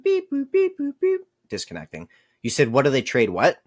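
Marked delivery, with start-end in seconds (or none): 0.0-2.1 high robotic beep-boop noises; headset beeping imitation. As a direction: chirp like a glitching headset. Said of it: "My headset's fucking boop".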